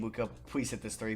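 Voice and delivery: normal talking voice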